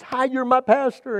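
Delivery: high, eager mock greeting; pitched well up for a cheery hello; excited greeting. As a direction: imitate an eager fan saying hello. Woman greets her pastor.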